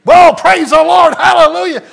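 shouting hallelujah in relief, high and loud; joyful shout. Retold or imitated. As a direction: burst out in thankful praise. Relief after hearing it's paid.

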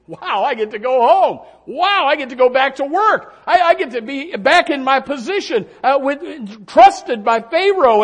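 loud, high, jubilant exclamations; rejoicing. Acted. Butler imagined celebrating his release, rejoicing. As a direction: marvel out loud as the freed butler. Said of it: "I imagine the butler just rejoicing".

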